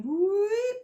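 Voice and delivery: single high rising whoop; the bird's alarm call again. Retold or imitated. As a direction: whoop like a siren starting up. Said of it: "it just started again".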